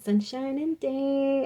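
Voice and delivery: high, drawn-out sunny chirp; Trolls vibe. Acted. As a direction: stretch it out high, all sunshine. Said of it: "like, Trolls vibe".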